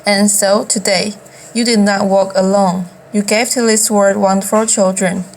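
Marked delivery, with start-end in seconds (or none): none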